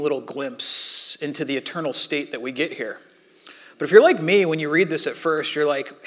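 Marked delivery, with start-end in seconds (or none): none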